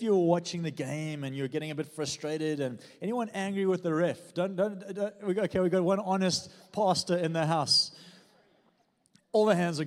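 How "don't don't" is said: ordinary talk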